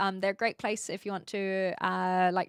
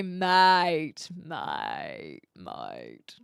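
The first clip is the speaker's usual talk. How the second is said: Aussie "mate" sinking much lower each time; badly faked Aussie, dropping deep; attempted Aussie accent; 5 semitones lower, much slower than usual. Shown as a demonstration. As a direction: try an Aussie drawl, lower and lower. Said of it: "I actually can't do an Aussie accent".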